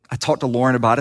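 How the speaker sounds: normal talking voice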